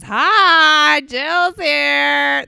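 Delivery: loud, high, stretched-out arrival greeting; hammed-up surprise entrance; Jill Zarin. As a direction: announce yourself in a big showy impression. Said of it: "That's my Jill Zan voice".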